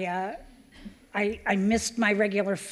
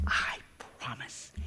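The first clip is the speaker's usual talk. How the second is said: whispered promise; 4 dB quieter, slower than usual. Retold or imitated. Vow to captain, whispered.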